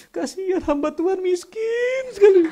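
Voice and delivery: high, loud hammed-up wail; mock crying. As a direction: wail in exaggerated pity, half laughing. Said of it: "nangisnya dengan berkata seperti ini".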